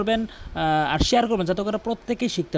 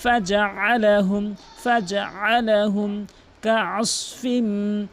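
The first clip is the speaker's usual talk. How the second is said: slow, drawn-out melodic tajweed chant; Quran recitation; 3 semitones higher, much slower than usual. Shown as a demonstration. Teacher reciting the verse for students, slow melodic chant. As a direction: chant each word long and measured.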